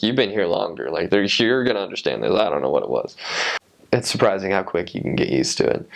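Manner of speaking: ordinary talk, nothing marked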